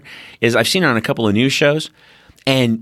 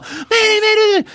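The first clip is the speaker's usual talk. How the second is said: much higher, frantic radio call; panicked mayday; 3 dB louder than usual. Retold or imitated. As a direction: scream for help over the radio.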